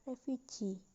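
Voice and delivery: refugee with main stress on the final gee, lighter stress on ref. Shown as a demonstration. Teacher showing a stressed suffix, refugee stressed on gee. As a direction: say refugee, leaning on the last syllable. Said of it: "The primary stress is".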